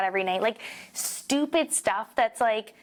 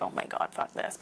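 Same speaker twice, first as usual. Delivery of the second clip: whispered mock complaint; 8 dB quieter than usual. Retold groan about tennis lessons.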